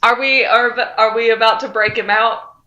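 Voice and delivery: ordinary delivery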